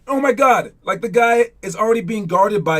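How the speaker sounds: exasperated outburst at timid players; frustrated coach outburst. Real, not acted.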